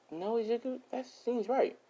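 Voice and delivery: high-pitched little creature voice; agreeing gingerbread man. Acted. Gingerbread man agreeing with Izuku, in a high voice. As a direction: chime in high and small.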